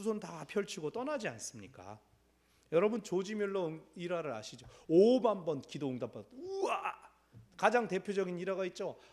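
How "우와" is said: high, breathy wow of wonder; mock amazement. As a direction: gasp out a marvelling wow. Marvelling at fifty thousand answers. (놀란 듯 높게 감탄)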